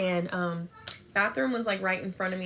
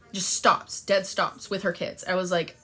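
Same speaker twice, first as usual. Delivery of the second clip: ordinary delivery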